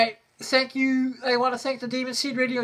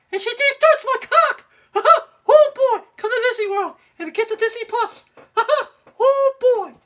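high, giggly cartoon mouse squeak; hammed-up high cartoon mouse with giggles; Mickey Mouse voice; 10 semitones higher, 5 dB louder than usual. Mickey Mouse bit, high laughing.

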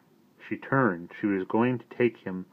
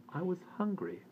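sulky; 11 semitones higher, 10 dB quieter than usual. A narrator's reading. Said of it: "said Omri sulkily".

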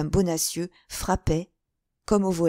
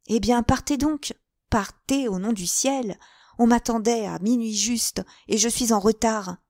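higher, louder impatient plea; urgent insistence; 5 semitones higher, 4 dB louder than usual. Acted. Woman late for midnight urging D'Artagnan away, urgent. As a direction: press him to go at once.

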